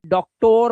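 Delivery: डॉक्टर ending in a long "tor"; wrong ending. Shown as a demonstration. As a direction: show the mistake, डॉक्टर as "dok-tore". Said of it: "मत बोलना". (डॉक्टर के अंत में लंबा ओ)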